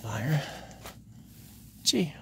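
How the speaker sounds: ordinary talk, nothing marked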